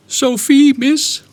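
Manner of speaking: high, small voice answering a matron; a scared orphan answering meekly; timid little girl. Retold or imitated.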